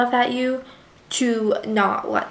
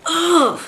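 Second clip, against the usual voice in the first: loud, higher ugh of frustration; exasperated groan; 6 semitones higher, 4 dB louder than usual. Real, not acted. Reader fed up with America, loud exasperated groan. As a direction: groan in disbelief at her.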